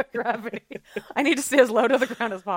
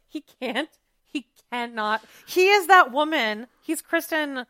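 ordinary talk

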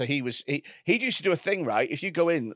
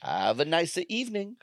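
put-on alluring Greek accent; 5 dB quieter than usual. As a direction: purr it in a sultry Greek accent. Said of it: "a Greek, Greek alluring".

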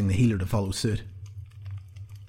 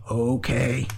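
drawn-out, encouraging exclamation; patronizing encouragement; 3 semitones higher than usual. A narrator's reading. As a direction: humour them, patronizingly. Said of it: "exclaimed the Bregu in an encouraging".

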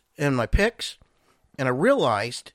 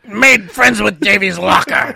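slow pirate drawl, a bit louder; 3 semitones higher, 6 dB louder, much slower than usual. Acted. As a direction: tell it like a salty old pirate.

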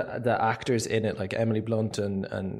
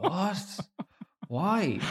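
high-pitched incredulous reaction, half laughing; mock disbelief; 8 semitones higher than usual. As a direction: replay your baffled reaction up high. Reacting to surprise casting.

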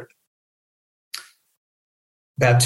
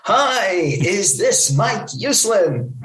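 exuberant, higher, drawn-out greeting; Stan Lee; 7 semitones higher, much slower than usual. Retold or imitated. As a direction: imitate a hugely enthusiastic caller, swooping and slow. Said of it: "it's this exuberant male voice".

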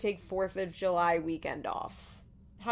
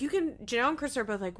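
ordinary talk, nothing marked

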